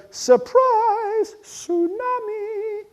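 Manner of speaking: high, drawn-out singsong announcement; Mother Nature. Acted. Mother Nature springing disaster on humans, high singsong. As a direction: trill it out like a gleeful surprise. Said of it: "Then Mother Nature goes".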